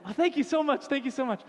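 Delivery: hammed-up overjoyed student; ecstatic thanks. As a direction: squeal your thanks like a thrilled kid. Student thrilled with new name.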